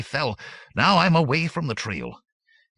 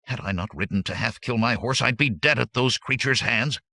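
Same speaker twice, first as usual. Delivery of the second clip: from subdued to higher, fervent telling; gaining fervency; 6 semitones higher than usual. A narrator's reading. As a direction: grow more urgent as the horror comes back. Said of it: "His voice gained fervency as he spoke".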